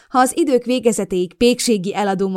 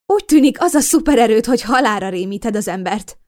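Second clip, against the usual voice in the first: nervous joking, higher and louder; trying to joke; 4 semitones higher, 8 dB louder than usual. A narrator's reading. Narrator nervously joking with startled neighbor. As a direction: force a light joke. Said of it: "Próbáltam humorizálni".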